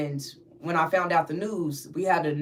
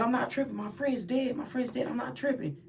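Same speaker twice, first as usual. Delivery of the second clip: higher, softer protest; tearful insistence; 5 semitones higher, 7 dB quieter than usual. Retold or imitated. Storyteller retells crying on the pod, high soft insistence. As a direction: plead softly that it is true, pitched up. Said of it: "I'm crying".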